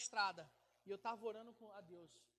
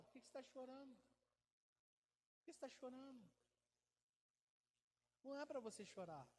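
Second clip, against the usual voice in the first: God's gentle voice; 10 dB quieter than usual. Retold or imitated. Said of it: "Deus respondeu".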